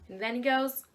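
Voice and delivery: ordinary delivery, nothing marked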